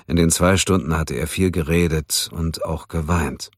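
plain reading voice

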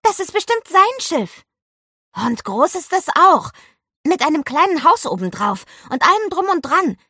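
high, loud, gushing excitement; bubbling over. A narrator's reading. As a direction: gush with excitement at the discovery. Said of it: "sprudelte es aus Olivia heraus". (aufgeregt sprudelnd, hoch und laut)

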